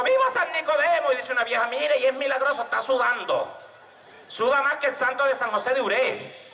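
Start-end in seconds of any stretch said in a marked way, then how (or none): 0.0-1.1 high festive cheer for the saint; crowd cheer. Acted. Procession crowd cheering the saint, high and excited.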